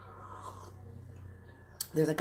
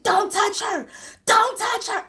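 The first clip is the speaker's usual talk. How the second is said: high, loud, repeated warning; imitated outraged yell; 14 semitones higher, 5 dB louder, slower than usual. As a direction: mimic a man making a shrill scene. Imitates man making a scene.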